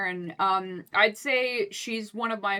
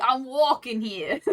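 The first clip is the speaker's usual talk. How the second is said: half-laughing, drawn-out Brooklyn accent; shitty Brooklyn accent; 4 semitones higher, 3 dB louder, slower than usual. Shown as a demonstration. Voice actor attempts Brooklyn for a friend, shitty Brooklyn accent. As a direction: try a bad Brooklyn accent, cracking up. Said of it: "I can do a really shitty".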